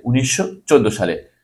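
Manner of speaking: ordinary talk, nothing marked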